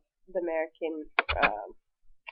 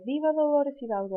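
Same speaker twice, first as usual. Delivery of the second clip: high, raised rallying call; governor's call; 7 semitones higher than usual. Retold or imitated. Teacher voicing the governor's call to the crowd, high. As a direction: proclaim it like the governor. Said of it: "And so he would say".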